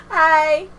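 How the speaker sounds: high, loud, put-on "hi"; laughing mock chipper hello; mock service greeting. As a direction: chirp it like a fake phone greeting. Mimicking own service greeting, laughing.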